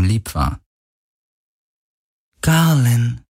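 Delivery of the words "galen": ratlos flüsternd. Acted. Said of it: "flüsterte die Stimme ratlos".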